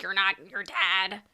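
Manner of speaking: slow, put-on crime boss voice; Nick Nolte. Boss correcting the young thief, slow put-on voice. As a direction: draw it out in a character voice. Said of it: "And Nick Nolte's like".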